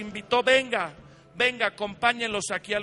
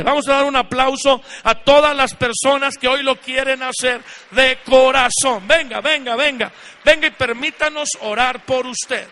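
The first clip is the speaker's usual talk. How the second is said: loud, fervent altar-call preaching; preaching at full force; 11 dB louder than usual. Acted. Pastor calling people to the altar, loud fervent preaching.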